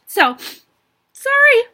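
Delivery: real tears, voice breaking as she apologises. Real, not acted. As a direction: voice cracks high and loud on sorry. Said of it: "I knew I'd get emotional".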